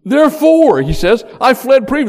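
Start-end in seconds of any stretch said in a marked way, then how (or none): 0.0-0.8 high, loud indignant "Therefore"; frustrated prayer. Acted. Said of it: "this is his prayer". 1.4-2.1 1 frustrated prayer. Acted. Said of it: "this is his prayer".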